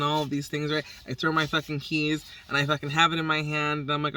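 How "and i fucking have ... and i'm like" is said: ordinary talk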